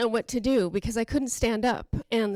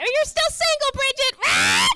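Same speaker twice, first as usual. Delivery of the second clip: very high, loud parrot screech; shrieking pet bird impression; squawking parrot; 12 semitones higher, 7 dB louder than usual. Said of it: "they would just go".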